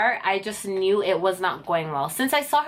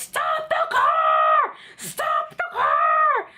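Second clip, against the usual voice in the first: drawn-out, very high mock scream; hammed-up screeching examiner in a panic; 3 dB louder, much slower than usual. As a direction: shriek the order, stretching each word out. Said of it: "Why are you screaming?".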